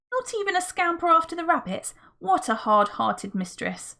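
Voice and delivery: a lightly voiced hurt pet; dog's complaint. A narrator's reading. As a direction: voice the sulky dog, lightly.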